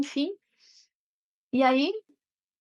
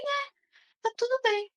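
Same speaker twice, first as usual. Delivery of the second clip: gentle confirmation; 12 semitones higher than usual. Retold or imitated. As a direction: gently confirm it's fine. Mother confirms softly.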